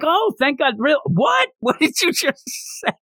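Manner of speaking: put-on high, squeaky flustered girl voice; girlish squeal. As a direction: play her as a flustered girl caught off guard. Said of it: "And she's like".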